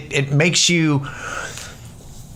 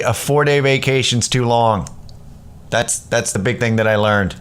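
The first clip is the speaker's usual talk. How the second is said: normal talking voice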